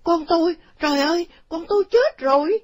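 high, loud wail of a mother; shrill-pitched panic, full voice; panicked scream. A narrator's reading. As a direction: cry out in horror for your child. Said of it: "bà kêu rú lên".

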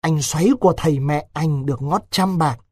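plain reading voice

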